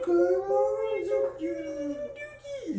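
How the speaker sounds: squeaky doting coo; cooing baby talk. Retold or imitated. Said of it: "He's like".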